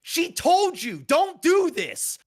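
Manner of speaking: high, slow yell at the screen; retold yelling. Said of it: "I'm yelling".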